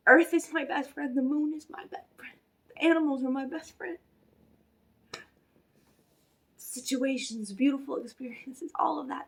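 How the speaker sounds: uplifting spiritual message. Acted. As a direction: declare it like a healer lifting the room, then hush.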